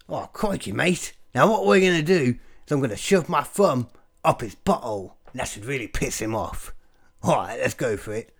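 hammed-up Australian accent; mock Aussie wildlife presenter confiding the plan; badly faked, bouncy Australian; crocodile hunter. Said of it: "the crocodile hunter putting his thumb up".

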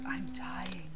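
faint whispered dying line. Retold or imitated. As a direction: breathe it out as a whisper. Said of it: "Very dramatic".

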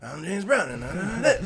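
high, loud raspy impression. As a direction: rasp it out in a high-pitched star's voice. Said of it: "he has a rasp 'cause he's [name]".

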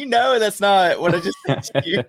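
high, loud incredulous rant at a friend; can't believe he got it this wrong; exasperated disbelief. Retold or imitated. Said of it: "I'm like".

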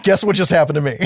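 mock excited dude. Travis calling his alien-obsessed family, excited. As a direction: blurt it out like big news. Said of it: "you're like".

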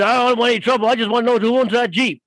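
lightly acted biker, pressing for an answer; insistent biker. Biker tells workers he wants the owner, imitated insistence.

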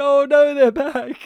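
loud, laughing "oh no"; laughing exclaim. Retold or imitated. Watching the bomb scene, loud laughing exclaim. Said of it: "I laughed out loud and I said".

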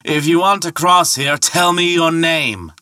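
high, carrying demand; calling out a challenge. Acted. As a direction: draw it out across the water. Ferryman challenges Thor across the water.

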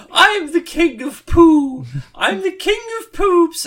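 very high, loud, slow squeaky proclamation; silly king voice. Retold or imitated. Comedian playing the king of poo on stage, very high and squeaky. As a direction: crow it like a ridiculous pompous poo king. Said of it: "Broden was like".

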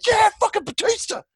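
high, screamed fan hype; primal scream. Retold or imitated. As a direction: yell it like an ecstatic wrestling fan. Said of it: "just primal scream, like".

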